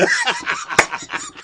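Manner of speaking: very high, squealing laugh; shrieking with laughter. Real, not acted. Laughing at own joke.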